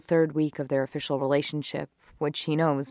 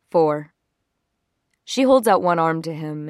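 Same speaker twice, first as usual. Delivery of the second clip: ordinary delivery, nothing marked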